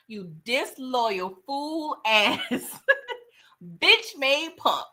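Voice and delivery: a slow, drawn-out string of insults, a laugh breaking in; slow cussing. Retold or imitated. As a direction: spit out each insult slowly. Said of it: "that was from the soul".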